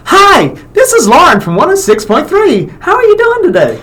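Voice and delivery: very high, chipper radio-host voice; Lauren impersonation. Acted. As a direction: pitch way up into an exaggerated chirpy falsetto greeting. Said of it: "do an impersonation of Lauren".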